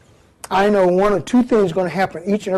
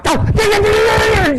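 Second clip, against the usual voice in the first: squeal; 14 semitones higher, 4 dB louder than usual. Retold or imitated. Woman runs off.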